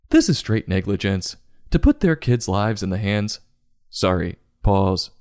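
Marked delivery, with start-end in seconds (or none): none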